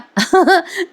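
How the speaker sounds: short high chuckle; brief giggle at the silliness; quick spontaneous laugh; amused laugh. Real, not acted. Amused by the botched English.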